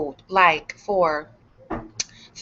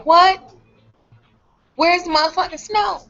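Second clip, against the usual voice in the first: outraged disbelief; 9 semitones higher than usual. Retold or imitated. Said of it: "I said".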